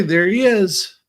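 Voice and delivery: ordinary talk, nothing marked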